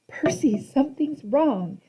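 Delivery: insisting. A narrator's reading.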